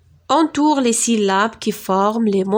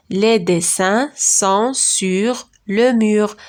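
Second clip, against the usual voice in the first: slow model reading; slower than usual. Shown as a demonstration.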